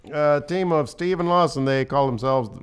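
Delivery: high Mid-Atlantic ring announcer; Mid-Atlantic accent. Pretend. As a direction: introduce the team in Mid-Atlantic. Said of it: "like, a Mid-Atlantic voice".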